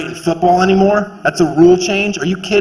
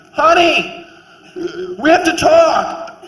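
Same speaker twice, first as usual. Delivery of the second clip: much higher, theatrical parent breaking big news; mock parent voice; 11 semitones higher, slower than usual. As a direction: play an over-earnest parent announcing proudly. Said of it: "I would have been like".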